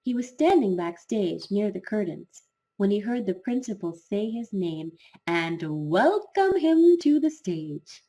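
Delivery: plain reading voice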